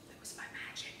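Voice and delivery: barely audible whisper; whispered aside. Pretend. Reader lets children in on the trick, whispered aside. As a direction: whisper it like a secret.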